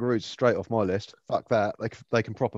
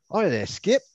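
higher-pitched mock Australian greeting; imitated Australian paraglider; 8 semitones higher, slower than usual. Paraglider greeting a kangaroo as he lands, imitated Australian accent. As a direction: play a chirpy Aussie hailing a kangaroo. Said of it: "the most Australian paraglider ever".

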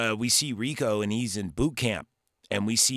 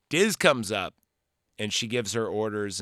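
ordinary talk, nothing marked

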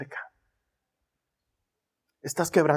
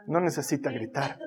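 usual speaking voice

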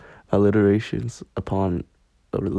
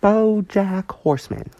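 "BoJack" said much higher, in a put-on cartoon manager's accent; Princess Carolyn voice; 12 semitones higher, 5 dB louder than usual. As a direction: say "BoJack" like the showbiz agent from the show, pitched way up. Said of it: "Princess Carolyn sounds".

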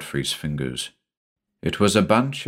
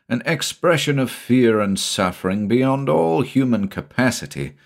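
usual speaking voice

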